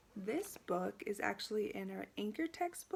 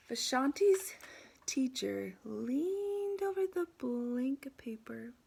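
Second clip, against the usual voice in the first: slow, higher storybook narration; picture-book reading; 4 semitones higher, 6 dB louder, slower than usual. A narrator's reading. Teacher reading a picture book to students, animated storybook narration. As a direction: stretch the words out for young listeners.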